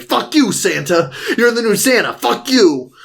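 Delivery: mock taunt. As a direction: hurl it playfully at him. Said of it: "He's like".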